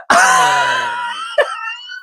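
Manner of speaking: squealing laughter; high wailing laugh. Real, not acted. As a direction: laugh until it hurts. Laughing at the crispy line.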